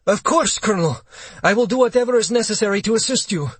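high, eager military compliance; desperately courteous. A narrator's reading. Cowed captain promises colonel his help, high and desperate. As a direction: snap to it, anxious to oblige. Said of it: "Desperately, Leonov fell back".

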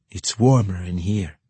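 put-on a sly, lewd young man inviting her in; leering, suggestive purr of a rogue. A narrator's reading. Young man teasing his lover at the window, sly and lewd. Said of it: "in his usual sly, lewd tone".

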